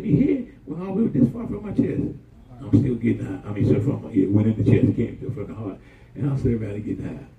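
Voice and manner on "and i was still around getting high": normal talking voice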